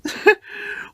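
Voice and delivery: high breathy engine giggle; Percy laughing at James's story. A narrator's reading. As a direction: snicker at James. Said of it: "laughed Percy".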